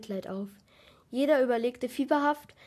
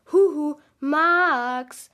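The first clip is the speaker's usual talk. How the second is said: loud, drawn-out singsong call; 4 semitones higher, 9 dB louder, much slower than usual. Acted. Narrator to daydreaming Max, calling out loudly. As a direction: call to get a friend's attention, stretching the name.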